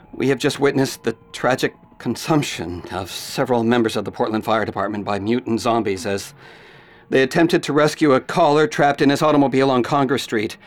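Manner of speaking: low, grave anchor read; news report. Acted. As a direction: report the disaster soberly.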